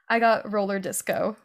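ordinary talk, nothing marked

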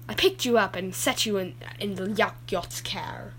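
ordinary talk, nothing marked

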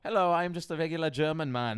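put-on light, comic German accent; playful attempt at a German man; German accent impression.

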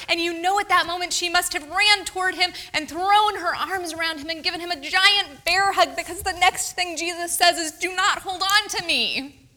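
high, loud and joyful; bright, eager storytelling; excited. Real, not acted. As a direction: burst with delight at the reunion. Joyful retelling of reunion.

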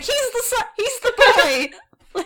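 high-pitched, excited hype; mock shouting. Co-host caught up proclaiming the golden boy, mock shouting. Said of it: "I'm just shouting".

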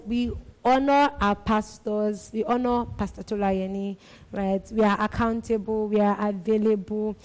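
usual speaking voice

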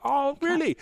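high, mock-surprised "Oh, really?"; mock surprise. Mocking his mate's news, mock surprised. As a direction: act falsely impressed.

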